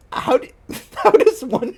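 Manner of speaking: high, broken up by laughter; cracking up. Real, not acted. Reader asking about the fanfic's absurd typo, cracking up. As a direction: try to ask it through real laughter.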